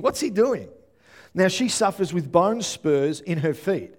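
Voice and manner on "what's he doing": higher, puzzled question; puzzled inner thought. Retold or imitated. Preacher repeating a woman's silent question, puzzled. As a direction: lightly voice her bafflement. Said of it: "this is her thinking".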